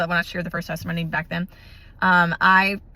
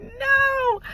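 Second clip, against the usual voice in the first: squealed refusal. Retold or imitated. Told more cavities, frustrated.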